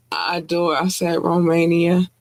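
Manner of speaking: normal talking voice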